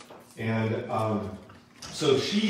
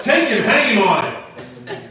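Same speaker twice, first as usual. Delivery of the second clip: high, loud, drawn-out command; slow, theatrical yell; shouted royal order; 10 semitones higher, 9 dB louder than usual. Retold or imitated. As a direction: act out a king bellowing the sentence. King orders Haman's hanging.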